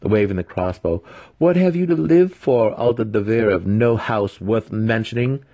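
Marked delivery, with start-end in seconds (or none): 1.4-5.4 high, loud mocking taunt; sly apprentice mocking a captive; teasing taunt. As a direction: taunt him while waving the crossbow. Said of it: "Massage teased".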